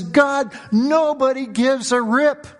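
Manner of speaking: high, drawn-out lament to God; crying out. Retold or imitated. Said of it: "He's crying out. He's just gushing out".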